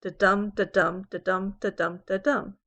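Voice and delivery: five even "da-dum" beats, each "dum" stressed; iambic beat. Shown as a demonstration. Teacher beating out pentameter, "dum" hit hard in each "da-dum". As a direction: chant "da-dum" steadily, weak then strong. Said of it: "a pentameter has five of these da-dums in a line".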